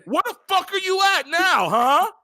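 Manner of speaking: very high, heated challenge; angry taunting. Real, not acted. Calling out absent Blake after Xbox layoffs, angry taunting. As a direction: call him out, pitch way up.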